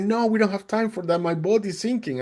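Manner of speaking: high, hurried captain's reply; urgent rescuer. Retold or imitated. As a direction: brush off the request, no time. Rescuer answers, boat sinking.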